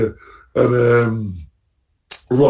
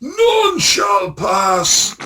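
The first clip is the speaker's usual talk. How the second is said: slow, drawn-out high shout of a guard blocking the way; mock shout; 12 semitones higher, 3 dB louder, much slower than usual. Watching dad stride ahead with his staff, a slow booming mock shout. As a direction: boom it out like a stubborn gatekeeper. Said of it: "I felt like shouting out".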